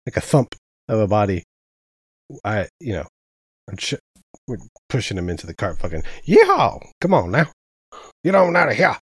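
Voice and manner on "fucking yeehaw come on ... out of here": higher and louder, a wild yeehaw; rowdy cowboy whoop. Acted. A player hollers while pushing him into the cart: rowdy, loud cowboy whoop. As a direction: whoop it up like a rodeo rider.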